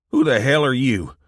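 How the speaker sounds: loud angry challenge; angry demand. A narrator's reading. Son confronting strangers in the living room, loud and angry. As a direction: bark it at the intruders.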